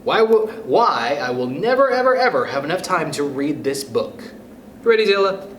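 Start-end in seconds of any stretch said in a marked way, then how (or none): none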